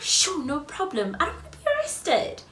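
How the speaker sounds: mock-sweet reply to a polite ask; mock eager agreement. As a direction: play it over-accommodating. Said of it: "I would have been like".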